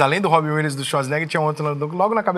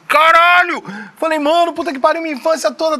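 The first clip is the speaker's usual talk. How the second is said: much higher, louder retold shout of disbelief; amazed outburst; 11 semitones higher, 5 dB louder than usual. Host recalling meeting his childhood hero's voice, amazed. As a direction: blurt it out in shock.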